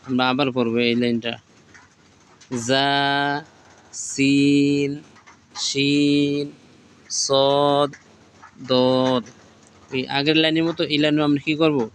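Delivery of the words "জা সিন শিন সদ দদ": letter names stretched long, one by one; slow, stretched Arabic letter names; drawn-out recitation. Shown as a demonstration. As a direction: draw out each letter name like a Quran teacher. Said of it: "যেভাবে পড়ছি এভাবে".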